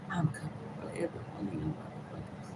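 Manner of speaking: a soft, unvoiced vow of revenge; whispered threat. Real, not acted. Vlogger vows revenge on the government in a whisper. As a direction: let the threat drop to a whisper.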